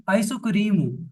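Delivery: आइसोक्रीमु said as ai-so-ku-ri-mu, a vowel after each consonant; आइसोक्रीमु with every syllable open, Japanese style; Japanese pronunciation. Shown as a demonstration. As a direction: spell out आइसोक्रीमु in Japanese sounds, not English ice cream. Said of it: "हम आइसक्रीम नहीं बोलेंगे Japanese में".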